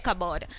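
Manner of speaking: "cupboard" said as cub-erd, no P sound; silent P. Shown as a demonstration. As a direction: leave the P out of "cupboard". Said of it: "we couldn't hear the sound".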